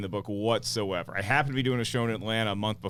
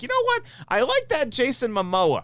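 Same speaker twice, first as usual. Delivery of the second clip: gushing fan voice; 11 semitones higher, 3 dB louder than usual. Retold or imitated. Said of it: "But if you're like".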